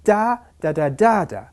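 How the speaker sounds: first "da" high and strong, then lighter "da, da"; "DA da da DA da" rhythm; rhythm in syllables. Shown as a demonstration. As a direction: beat out the "da" syllables, stressing first and fourth. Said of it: "The resulting rhythm is".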